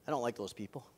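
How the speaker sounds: ordinary delivery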